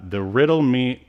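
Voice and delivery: ordinary talk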